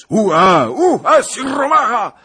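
wysoki, bardzo głośny krzyk na wilki; wrzaśnij z całych sił, odstraszając zwierzęta; donośny okrzyk. A narrator's reading. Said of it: "rozległ się jego głos". (Zagłoba krzyczy na wilki, głośny okrzyk)

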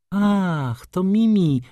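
put-on high, loud little girl's cry; child's exclamation. A narrator's reading. Little Helunia recognising the doll, high excited cry. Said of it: "zawołała Helunia".

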